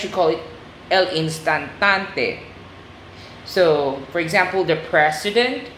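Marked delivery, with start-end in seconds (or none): 0.9-2.4 "instantante" sounded out slowly in Spanish, stress on the last "tan"; Spanish pronunciation. Shown as a demonstration. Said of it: "So in Spanish".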